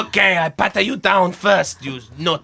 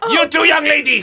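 shouting; 8 semitones higher than usual. Acted. Guard ordering passengers out.

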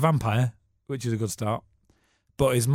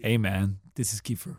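low, laid-back American celebrity voice; Kiefer's greeting; 4 semitones lower than usual. Retold or imitated. Imitating Kiefer Sutherland's phone greeting to the host, low and casual. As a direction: drop low and casual like a film star.

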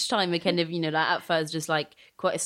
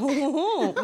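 mock catchphrase; 8 semitones higher than usual. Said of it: "which I famously say a lot".

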